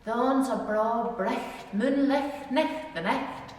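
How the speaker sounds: slow, broad Ulster Scots recitation. Shown as a demonstration. Teacher demonstrating an Ulster Scots saying to the class, slow broad Ulster Scots. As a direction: roll it out slowly in thick Ulster Scots. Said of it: "here's a wee Scottish expression, try this one".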